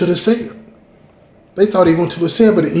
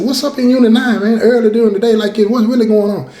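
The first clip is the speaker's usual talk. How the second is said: excited; 7 semitones higher than usual. Retold or imitated. Surprised self quote, urgent.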